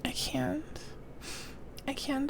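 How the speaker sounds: unvoiced weary whisper. Real, not acted. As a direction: whisper it, giving up. Tired of reading aloud.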